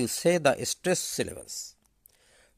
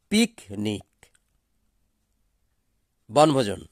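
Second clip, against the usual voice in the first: ordinary talk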